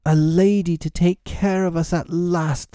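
high, dismayed cry; anguished lament. A narrator's reading. Twin grieving a lady to care for them, high lament. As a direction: wail it high, as over a loss.

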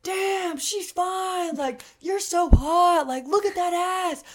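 hammed-up middle schooler catcall; catcalling boys. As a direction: imitate teen boys leering. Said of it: "They're like".

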